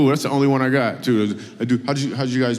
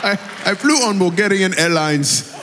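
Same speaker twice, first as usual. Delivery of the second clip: higher mimicry of a Zimbabwean accent; playful Zimbabwean accent impression; imitated Zimbabwean accent; 5 semitones higher than usual. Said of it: "Her voice, she got the voice".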